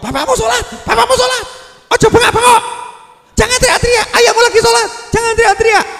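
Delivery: very high, loud mock scolding; mother out-yelling child. Preacher acting a mother hushing her child during prayer, screeched loudly. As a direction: shriek a frantic telling-off, hammed up. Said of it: "suaramu ngungkuli anakmu".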